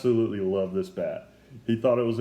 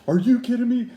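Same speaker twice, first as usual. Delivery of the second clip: high, louder retold panic; freaking out; 11 semitones higher, 6 dB louder than usual. Fan panicking over a ruined bat inscription, high and loud. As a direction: blurt it in shocked dismay. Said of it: "I am freaking out at this point".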